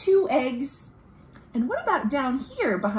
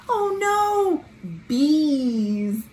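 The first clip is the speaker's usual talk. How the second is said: mock alarm; 6 semitones higher, 4 dB louder, much slower than usual. Bees block the path.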